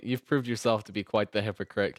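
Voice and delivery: ordinary delivery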